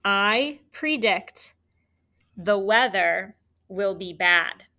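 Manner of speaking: slow, every word spaced out; teacher dictating the sentence; slow dictation. Shown as a demonstration. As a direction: dictate the whole sentence slowly. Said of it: "One more time".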